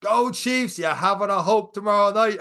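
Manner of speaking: high teasing shout; imitating the MC. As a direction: heckle him from the mic. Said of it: "he was like".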